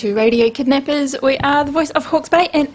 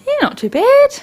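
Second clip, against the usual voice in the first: mock greeting; 13 semitones higher, 4 dB louder than usual. Playful greeting exchange.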